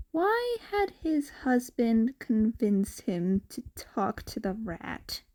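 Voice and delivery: ordinary delivery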